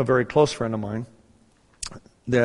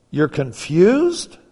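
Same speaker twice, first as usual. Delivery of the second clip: slow, rising disbelief, imitating his wife; incredulous wife; 4 semitones higher, 5 dB louder, much slower than usual. Wife questions surgeon on phone, incredulous. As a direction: stretch the question high and loud, mock astonishment. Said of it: "She goes".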